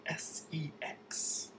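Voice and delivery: whispered spelling, letter by letter; hush-hush, as if it were naughty; coy whisper. Acted. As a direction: spell it out in a hushed mock whisper. Coyly spelling out a taboo word.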